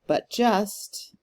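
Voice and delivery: light "but", weighted "just"; deliberate iambic lean on "just"; stress on JUST. Shown as a demonstration. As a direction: land the beat on "just". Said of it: "emphasis on just".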